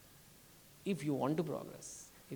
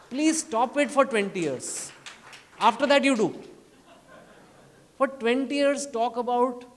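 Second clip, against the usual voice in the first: loud plea; 5 semitones higher, 8 dB louder than usual. Real, not acted. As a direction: plead with the crowd at full voice. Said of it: "going to beg people".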